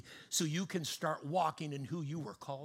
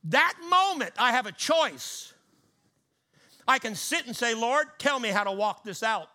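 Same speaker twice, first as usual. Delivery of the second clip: louder, higher, driving sermon delivery; full-force preaching; 5 semitones higher, 5 dB louder than usual. Acted. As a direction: preach it out loud with rising fervour.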